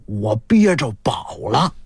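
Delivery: low, hushed secretive confiding; furtive, jittery secrecy. Acted. Mechanic confiding his treasure find, low and secretive. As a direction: drop low as if hiding a treasure. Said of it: "又神经兮兮的".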